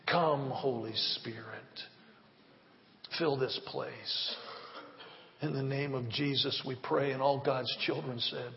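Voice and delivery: soft, fading closing prayer; hushed prayer. Real, not acted. Pastor closing the prayer with the church, hushed. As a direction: let it sink to a near hush.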